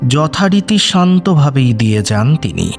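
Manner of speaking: usual speaking voice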